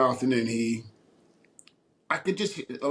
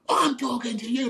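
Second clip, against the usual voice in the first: high, sharp mock retort; retold snap; 12 semitones higher than usual. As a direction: bite back at him. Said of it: "like that".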